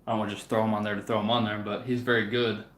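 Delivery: usual speaking voice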